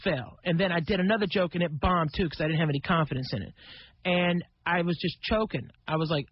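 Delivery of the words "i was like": ordinary talk, nothing marked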